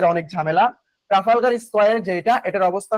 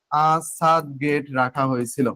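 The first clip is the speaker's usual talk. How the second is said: slow, lower-pitched repeat for note-taking; slow dictation; 6 semitones lower, slower than usual. Shown as a demonstration. Teacher repeating the gate's new name, slow dictation. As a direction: draw the words out, pitched down.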